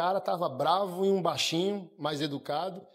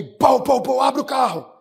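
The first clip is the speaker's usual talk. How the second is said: loud, higher banging yell of a cop; police shout; 7 semitones higher, 8 dB louder than usual. Retold or imitated. Cop pounding the car window, loud shout. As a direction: pound the window and bark the order.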